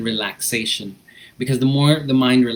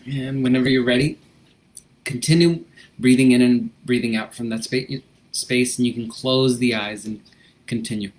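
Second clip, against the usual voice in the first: gentle instructor easing the class into breathing; guided breathing. Acted. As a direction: calmly guide them through the breath.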